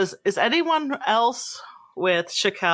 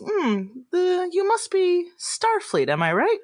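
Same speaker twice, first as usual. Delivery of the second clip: higher, lilting musing that drops at the end; gracious celebrity lady; 10 semitones higher than usual. Acted. Idol sizing up two fans, gracious lilt. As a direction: muse sweetly, then check your guess. GM plays Zashawn.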